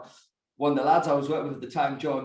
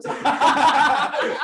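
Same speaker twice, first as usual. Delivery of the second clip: laughing; 10 semitones higher, 7 dB louder than usual. Real, not acted. Laughing about explicit story.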